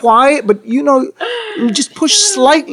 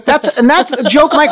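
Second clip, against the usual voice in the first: ordinary talk, nothing marked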